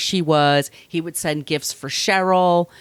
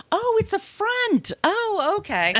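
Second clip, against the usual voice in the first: high, slow mock epiphany; dawning realization; 3 dB quieter, slower than usual. Teller finally grasping the shop is a front, mock realization. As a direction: let it sink in slowly, pitched way up. Acts out own late realization.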